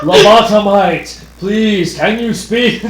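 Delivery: loud, slow calling out; loud pleading call. Acted. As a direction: shout it across the room. Calling to cartoon character.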